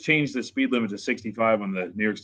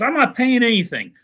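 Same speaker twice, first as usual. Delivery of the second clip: much higher, louder mock refusal; mock defiant refusal; 11 semitones higher, 5 dB louder than usual. Trucker refusing the judge, mock defiance. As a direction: imitate the trucker flatly refusing. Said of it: "he's all mad about it".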